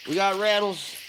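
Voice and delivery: talking around a cigarette; cigarette in mouth. Real, not acted. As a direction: speak with a cigarette between the lips. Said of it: "Let me light this cigarette here".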